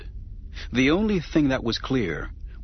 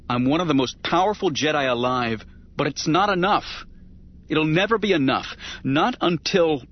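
builds higher and louder to a desperate peak; climbing, desperate outburst; rising frustration; 5 semitones higher, 4 dB louder than usual. Acted. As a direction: push up into anguished insistence. Frustrated outburst about power.

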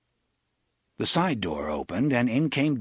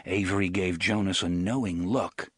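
usual speaking voice

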